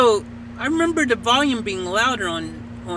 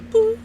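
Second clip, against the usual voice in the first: short high-pitched "boo" beep; imitated beep; 13 semitones higher than usual. Showing listeners the app's beep before it talks, imitated beep. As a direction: mimic a little electronic beep. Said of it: "there's a little beep".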